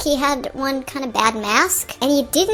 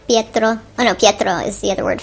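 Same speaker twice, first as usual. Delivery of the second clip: usual speaking voice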